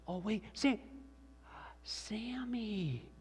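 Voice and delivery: high, soft voice dropping into a whisper; hushed pretend wonder. Dad stages penny trick at son's ear, hushed. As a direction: whisper the name as if making a discovery.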